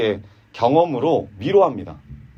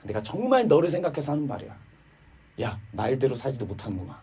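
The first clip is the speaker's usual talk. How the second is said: softer, sneering under false kindness; fake concern; 7 dB quieter than usual. Eliphaz posing as comforter to Job, quiet sneer. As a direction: sound caring, then twist the knife. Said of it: "이렇게 위로를 하고 있는 거예요".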